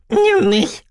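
viel höher und lauter quengelnd; noch quengeliger. Acted. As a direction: steigere das Betteln zum Quieken. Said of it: "quengelte sie". (noch höher, lauter und quengelnder)

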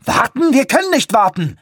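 high, incredulous shout; very high, exasperated cry; outraged protest. A narrator's reading. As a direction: protest frantically against the delay. Frantic objection to waiting.

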